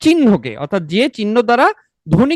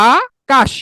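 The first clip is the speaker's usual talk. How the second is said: আকাশ split as আ, pause, কাশ; broken into syllables; 4 semitones higher, much slower than usual. Shown as a demonstration. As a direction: say Akash in two separate beats, first আ drawn out. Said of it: "ভেঙে উচ্চারণ করলে কি হবে".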